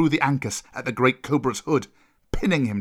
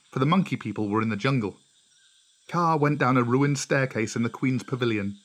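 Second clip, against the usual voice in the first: ordinary delivery, nothing marked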